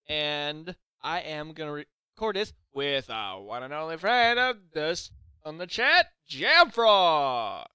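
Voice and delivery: drawn-out, booming game show introduction; hammed-up game show announcer; game show host. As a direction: build up to a big, loud reveal of the name. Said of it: "sound like some sort of game show".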